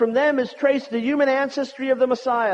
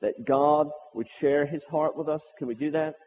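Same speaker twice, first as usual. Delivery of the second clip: prayer; 8 semitones lower than usual. Acted. Opening prayer, soft and low.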